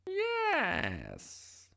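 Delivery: very high, drawn-out witch's yes; storybook witch's high welcome. A narrator's reading. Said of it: "said the witch".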